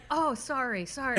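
imitating a lightly chastened apology; sheepish retold "sorry", repeated; reprimanded. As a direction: say sorry like someone just told off. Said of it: "his voice sounded reprimanded".

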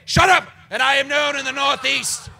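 booming high wizard proclamation; grandiose wizard. Acted. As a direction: proclaim every title like a pompous wizard. Wizard's grand self-introduction.

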